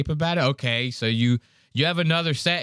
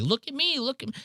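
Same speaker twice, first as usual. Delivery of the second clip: put-on high, childish plea for attention; mock a needy kid begging to be seen; a hammed-up attention-seeking child; whiny child; 12 semitones higher, 4 dB quieter, faster than usual. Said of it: "like a child trying to be".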